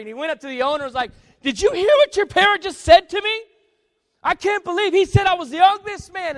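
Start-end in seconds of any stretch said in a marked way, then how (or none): 1.4-6.4 very high, loud, outraged complaint; hammed-up indignant shouting at the top of the voice; angry outrage. Angry customer complaining to the shop owner, hammed-up outrage. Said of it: "the man was so angry".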